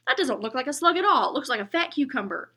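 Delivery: put-on loud, high jeering kid; a bully's loud high-pitched mockery; mocking kid. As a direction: taunt him about the drawing. Imagined bully mocking the drawing.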